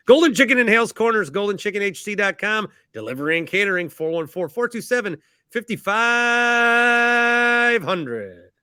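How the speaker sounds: high, loud sponsor plug; big ad voice with a hugely stretched last "five"; hammed-up ad read. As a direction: pitch it like a cheesy commercial.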